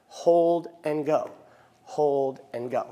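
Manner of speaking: slow, rhythmic hold and go; counting hold and go. Shown as a demonstration. Teacher demonstrating the new rhythm, slow count. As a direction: lean on hold, release on go. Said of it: "it's gonna look like this".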